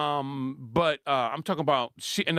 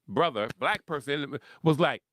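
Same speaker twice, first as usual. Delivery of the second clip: usual speaking voice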